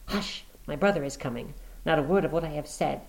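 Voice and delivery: hushed. Acted. Woman silencing Watson as brother nears, hushed. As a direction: hush him before the brother hears.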